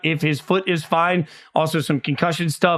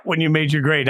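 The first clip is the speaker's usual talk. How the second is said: ordinary talk, nothing marked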